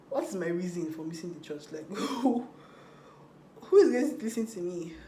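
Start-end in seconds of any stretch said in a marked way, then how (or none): none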